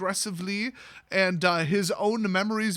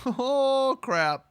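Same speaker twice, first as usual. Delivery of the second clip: mock surprise; 7 semitones higher, 3 dB louder than usual. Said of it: "she's like".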